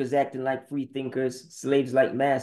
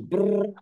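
lip trill; 7 semitones higher than usual. Acted.

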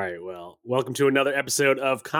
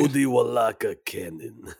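put-on name said in a Russian accent. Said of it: "say Cody Walaka Cannon in a Russian accent".

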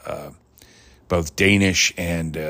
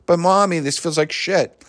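higher-pitched mock child complaint; complaining child; 8 semitones higher than usual. Sick kid protests to mommy, higher and plaintive. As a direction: plead with mommy like a little kid.

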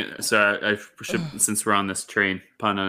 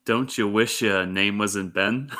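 slow, deliberate repeat; slower than usual. Real, not acted. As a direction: draw the punchline out slowly, amused. Repeats the joke for those who missed it.

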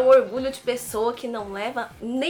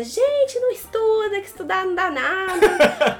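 mock funkeira voice; 7 semitones higher than usual. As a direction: shout it like a wild funk singer. Voicing [name] at school.

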